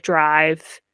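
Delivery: ordinary delivery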